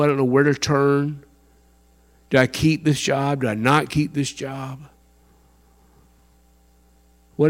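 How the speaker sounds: soft, quiet pleading prayer; humble prayer. Shown as a demonstration. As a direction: pray low and desperate for guidance. Praying aloud as example.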